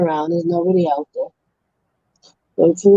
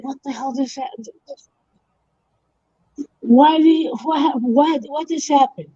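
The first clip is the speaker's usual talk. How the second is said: higher, panicky disbelief; baffled panic; 5 semitones higher than usual. Acted. Guy at the hole, stunned by the goat, panicky disbelief. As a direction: blurt it out, completely thrown.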